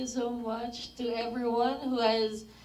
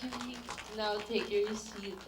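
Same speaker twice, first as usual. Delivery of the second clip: ordinary talk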